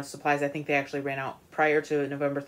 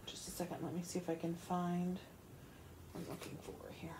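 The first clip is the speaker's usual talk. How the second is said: whispering; 17 dB quieter than usual. Real, not acted. Searching aside off mic.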